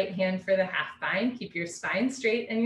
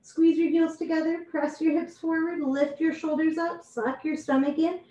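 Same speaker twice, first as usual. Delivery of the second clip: higher voice squeezed by the posture; helium-like voice; 6 semitones higher than usual. Real, not acted. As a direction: instruct with the voice box compressed upside down. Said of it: "sounds like you're on helium".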